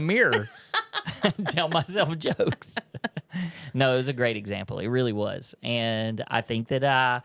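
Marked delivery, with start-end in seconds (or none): 0.7-1.2 very high squeak of a laugh; short shrieking giggle. Real, not acted. Laughing at own joke. 1.2-2.8 slow words through laughter; laughing. Real, not acted. Laughing at own joke. 2.8-3.7 laughter dying away, softer; a quiet last chuckle; soft trailing laugh. Real, not acted. Laughing at own joke.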